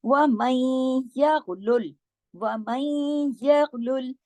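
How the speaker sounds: यगलुल recited slowly with a thick, heavy ghain; slow chant of यगलुल, ghain heavy and the rest light. Shown as a demonstration. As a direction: draw out यगलुल, ghain full-mouthed. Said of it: "सिर्फ गैन".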